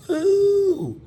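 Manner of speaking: falsetto whoop; high woo. Acted. Mock exclamation, very high.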